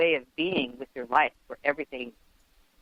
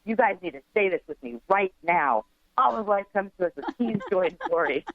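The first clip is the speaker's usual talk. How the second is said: mock yell; 6 semitones higher than usual. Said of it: "I remember yelling at them".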